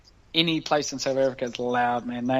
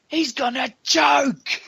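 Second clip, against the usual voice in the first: high, slow Kiwi heckler shout; yelled taunt; 11 semitones higher, much slower than usual. Retold or imitated. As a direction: heckle the kicker across a silent stadium. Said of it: "hearing this Māori guy yell out".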